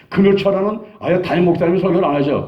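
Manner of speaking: ordinary talk, nothing marked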